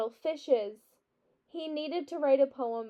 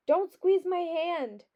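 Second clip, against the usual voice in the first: protesting; 6 semitones higher, slower than usual. A narrator's reading. Flora objects to hand being squeezed.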